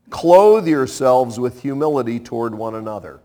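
usual speaking voice

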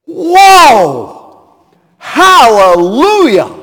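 loud, high, joyful cry of praise; joyful shout. Shown as a demonstration. Preacher demonstrates shouting with joy to the Lord. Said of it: "the people shouted with joy".

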